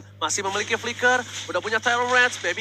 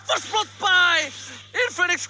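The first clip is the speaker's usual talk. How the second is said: excited; 9 semitones higher than usual. Real, not acted. Caster hypes a first blood.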